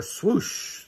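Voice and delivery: breathy swoosh of wind; airy wind sound effect; whooshing wind. A narrator's reading. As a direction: blow it out like a gust.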